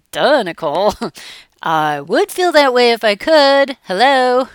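mocking client, laughing; lightly hammed-up sarcastic client; sarcastic duh. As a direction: scoff at the obvious with a laugh. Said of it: "as if".

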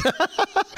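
short high-pitched laugh. Real, not acted. Host amused at charging for the accent, laughing. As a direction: laugh at your own joke.

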